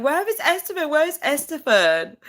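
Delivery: high, loud calling for a friend; excited calling. Retold or imitated. Streamer retelling how she called for Esteban, high and eager. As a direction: call out for him eagerly. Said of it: "And I was like".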